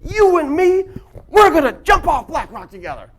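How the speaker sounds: put-on high, loud excited kid voice; a boy thrilled at the cliff, shouting. Son begging dad to jump, high and loud. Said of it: "and he says".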